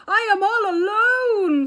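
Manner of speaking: very loud, high, slow terrified wail; screaming. Acted. Toad alone on the runaway sled, screaming in fright. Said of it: "screamed Toad".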